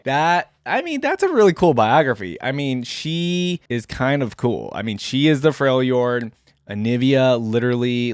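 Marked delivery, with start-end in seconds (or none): 0.0-2.4 excited. Real, not acted. Reacting with delight to lore.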